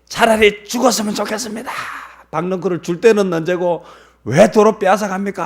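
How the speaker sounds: high-pitched, loud protest to God; resentful complaint. Acted. Jonah complains to God over the withered gourd, resentful. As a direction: complain bitterly, pitched well up. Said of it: "하나님 앞에 원망을 하니까".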